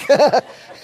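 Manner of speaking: a quick burst of real laughter; short laugh. Real, not acted. Pastor laughing at sleepy campers, a short real laugh.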